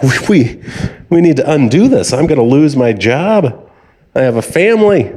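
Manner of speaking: high, pleading scammer act; mock panic. Scammer begs the old caller to undo it, mock panic. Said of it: "then they start freaking out".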